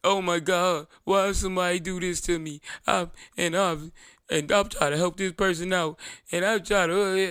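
mock him as a self-pitying victim; mock whiny landlord.

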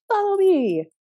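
put-on child leader. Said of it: "And he said".

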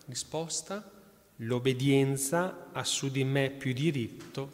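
lettura solenne, ritmo regolare; lettura agiografica. Acted. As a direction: racconta la vita del santo al pubblico. Reads saint's life aloud. (lettura devota ad alta voce)

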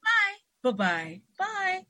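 stewardess farewell. Acted. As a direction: keep tossing out stewardess farewells. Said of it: "how the stewardess says when you leave the airplane".

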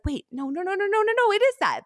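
flustered protest. Retold or imitated. Eager protest, retold.

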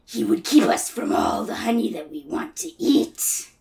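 low, menacing creature voice; sinister symbiote. Acted. Symbiote tempts Pooh with honey, sinister. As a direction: coax darkly, pitched well down.

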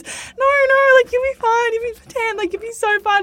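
high, eager reassurance; imitating a friend's reassurance; high reassuring. Friend consoling her crying.

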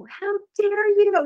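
high, slow mock-outraged cry; mock outrage. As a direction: ham up a fired-up how dare you. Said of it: "I can get fired up and, like, yell".

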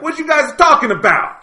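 high, loud, hammed-up Italian American accent; mock a cartoon Italian mobster, way over the top; mocking an actor's exaggerated Italian accent, hammed up. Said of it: "the most exaggerated Italian accent".

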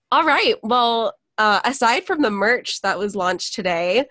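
ordinary talk, nothing marked

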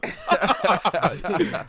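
laughing loudly through it. Real, not acted. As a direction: crack up while saying it.

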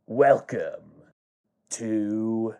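slow, drawn-out, low announcer welcome; hushed build-up on a stage welcome. Acted. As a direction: draw the words out softly like a showman at the mic. Danny speaking into the mic.

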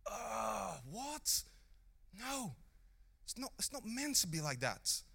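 high-pitched, appalled disbelief; shocked dismay. Retold or imitated. Preacher reacting to how things are, acted dismay.

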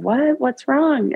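high, urgent retold question; alarmed concern. Husband answering his sobbing wife on a call, alarmed. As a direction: imitate a worried partner, pitch jumping up.

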